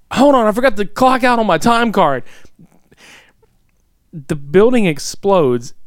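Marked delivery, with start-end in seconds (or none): 0.1-2.2 mock casual Arby. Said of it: "he's like".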